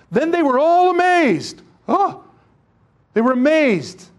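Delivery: amazed exclaiming. Acted.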